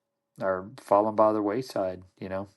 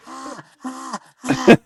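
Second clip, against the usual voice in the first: far higher mock shrieks, the last one much louder; girly scream; 9 dB louder, much slower than usual. Retelling how scared John shrieked in the truck, far higher mock screams. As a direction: shriek like a terrified girl, hammed up. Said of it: "He starts screaming like a, like a girl".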